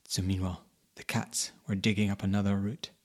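plain reading voice

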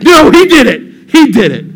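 loud, high accusing outburst; mock frantic blaming. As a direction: shout the blame onto someone else, hammed up. Acted blame-shifting in retelling.

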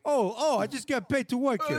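high hammed-up Queens accent, casual; Queens guy. Queens guy explaining his job to the car owner, high and breezy. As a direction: toss it off like a Queens guy on the clock. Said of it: "like the guys from Queens".

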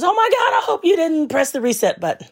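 high, alarmed customer service voice; panicky agent. Retold or imitated. Agent panics at caller about the reset button, high alarm. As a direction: squeal it like a panicked tech. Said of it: "And he goes".

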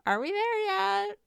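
put-on high whiny kid voice; pestering child. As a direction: nag like a bored kid in the car. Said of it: "asking you".

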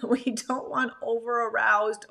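normal talking voice

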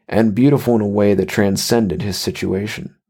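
usual speaking voice

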